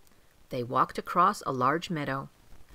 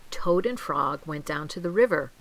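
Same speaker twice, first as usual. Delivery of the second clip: ordinary delivery, nothing marked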